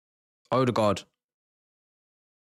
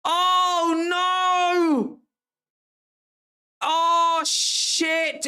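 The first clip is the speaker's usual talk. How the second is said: real anguished shout; much slower than usual. Real, not acted. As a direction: yell out in real agony as the header almost goes in. Near miss header.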